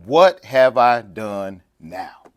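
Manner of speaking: slow, stretched exasperated question; drawn-out protest. Retold or imitated. David protesting to his brother, very slow and exasperated. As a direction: stretch every word in disbelief. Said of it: "And David said".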